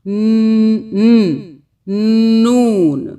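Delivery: "न-, न-, नून" broken up and loud, building to the full word; sounding out. Shown as a demonstration. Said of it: "पहला word क्या हुआ?". ("न" से "नून" तक अलग-अलग)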